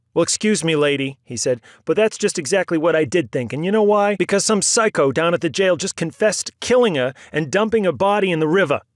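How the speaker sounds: loud, high furious comeback; angry retort. A narrator's reading. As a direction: snap back at her. Bond snapping back at aunt.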